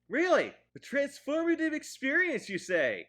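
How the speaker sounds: high, loud sarcastic questioning; mock incredulity. Reviewer scoffing at the film's line, mock incredulous. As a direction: throw the phrase back in feigned astonishment. Mocks the phrase just quoted.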